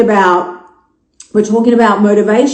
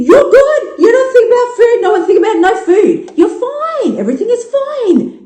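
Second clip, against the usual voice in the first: much higher, sing-song and carefree; sing-song nothing-wrong voice; mock carefree; 12 semitones higher than usual. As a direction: play it breezy and oblivious.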